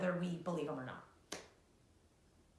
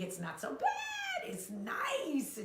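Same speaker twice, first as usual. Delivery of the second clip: much higher, sing-song sweet tone; mock sweet excuse; slower than usual. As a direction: sound falsely sweet. Voicing a vague excuse.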